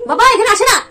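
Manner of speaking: high, loud laughing no-no-no; laughing protest. Real, not acted. Mother laughing as her son climbs in, a high laughing protest.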